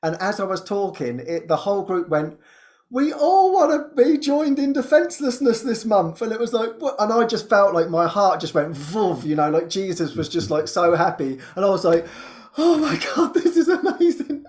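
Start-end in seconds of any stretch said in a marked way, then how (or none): 2.9-6.2 high, keen chorus voice; imitated group eagerness. Whole group answering the prayer leader, imitated in eager high voice. 12.6-14.4 high, delighted amazement; retold elation. Leader so happy at the group's answer, retold in high delight. Retells her joy at group's response.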